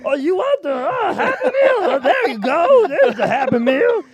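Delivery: a hammed-up frantic cashier; Bobcat Goldthwait voice. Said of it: "sounded like Bobcat Goldthwait".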